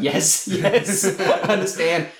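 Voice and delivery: high-pitched mock yeses, laughing; imagined eager agreement. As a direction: play an eager viewer getting it, amused. Imagines Phoebe cheering on the plan.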